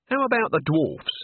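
can't resist asking, though it may hurt; reluctant probing. A narrator's reading. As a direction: poke gingerly at a sore subject. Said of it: "as someone might who had found a hitherto unsuspected boil".

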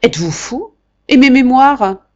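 loud, high indignant outburst; the marquis raging that his memoirs come first; outraged old man. Acted. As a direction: explode at the secretary.

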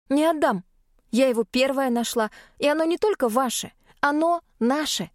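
clutching a prize, refuse to give it up; stubborn protest. Acted. Heroine refuses to give egg.

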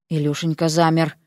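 ordinary delivery, nothing marked

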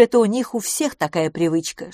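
ordinary delivery

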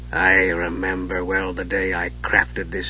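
put-on low, soft, slow old voice; sad old dwarf. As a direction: remember it with regret. Old dwarf begins sad memory.

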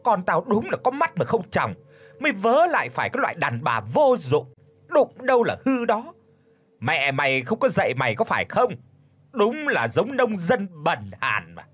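high, fast screeching insults; roaring abuse. Acted. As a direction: curse her out over the broken cup. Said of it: "tiếng gầm lên của bà Cấm". (mẹ chồng gầm lên chửi con dâu)

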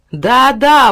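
high, loud eager agreement; exclamation. A narrator's reading. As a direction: cry out in agreement. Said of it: "воскликнул Сахар". (громкий возглас)

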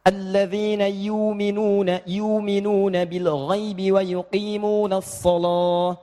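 slow chanted Warsh reading with long vowel in yu'minuna; slow melodic chant in the Warsh style; Warsh recitation. Shown as a demonstration. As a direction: recite "yu'minuna" drawn long without the hamzah. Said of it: "Kalau di Warash".

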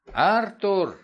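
loud alarmed cry of his son's name; shouting a name. A narrator's reading. Father catching his son at night, loud cry. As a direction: call out sharply, caught in the act. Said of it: "закричал я".

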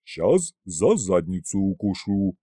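slow, lightly voiced rude dragon. A narrator's reading. Rude dragon threatening to bite, lightly gruff. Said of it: "пообещал грубый Горыныч".

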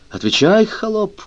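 high-pitched imperious demand; acted cry. A narrator's reading. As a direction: cry out as a master to a servant, without full volume. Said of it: "крикнул Фернан".

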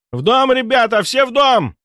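high, loud urgent shout; breaking into a shout. Acted. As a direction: yell the order, voice breaking. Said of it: "сорвался на крик Размыслов".

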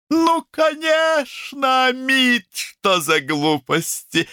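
high, drawn-out exclamation; exclaiming. A narrator's reading. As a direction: brush it off with an emphatic, high-pitched cry. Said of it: "воскликнула доктор Ила Чаттопатхьяй".

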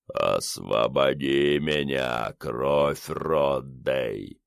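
deep, slow otherworldly rumble; dark lord. A narrator's reading. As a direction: rumble it like a voice from the grave. Skull monster speaks.

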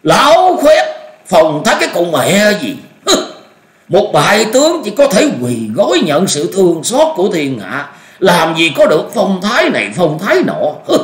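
put-on high, loud enraged old master; furious outburst. A narrator's reading. Raging at a defeated rival, furious. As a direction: rage at a despised rival, voice pitched high. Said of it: "nổi cơn thịnh nộ nói".

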